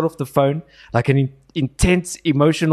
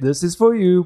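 Piers Morgan's way of speaking; 6 semitones higher than usual. Retold or imitated. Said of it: "he repeated exact".

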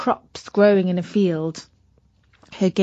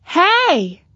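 Katy calling out; 9 semitones higher, 4 dB louder than usual. Acted. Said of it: "said Katy".

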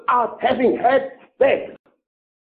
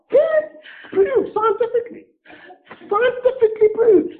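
pitched way up in mock agreement; high mocking sarcasm; 12 semitones higher than usual. Real, not acted. Preacher mocking scientists with a high sarcastic voice. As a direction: sneer it in a high voice.

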